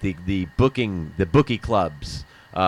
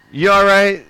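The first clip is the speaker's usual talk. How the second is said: loud, higher concerned question; cop checking in; 11 semitones higher, 10 dB louder than usual. Retold or imitated. Cop asking the car owners, a loud concerned question. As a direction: check on the couple. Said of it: "and they go".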